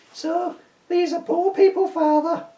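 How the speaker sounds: put-on high, young boy's voice; a posh boy asking his dad innocently. Boy character voiced in script read.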